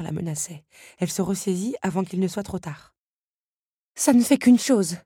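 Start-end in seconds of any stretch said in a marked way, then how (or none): 4.0-5.0 high, loud protest; objecting. A narrator's reading. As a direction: object sharply, pitched up. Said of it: "objecta-t-elle à la comtesse". (objection vive, voix haute)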